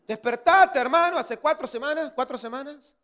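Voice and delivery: high, loud mock scolding; exasperated wake-up call. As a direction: call out to rouse a dozy brother. Acted retort to the sleepy member. (reproche exasperado, agudo y fuerte)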